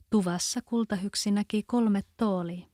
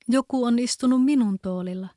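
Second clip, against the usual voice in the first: ordinary delivery, nothing marked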